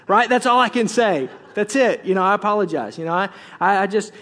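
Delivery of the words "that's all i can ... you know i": ordinary talk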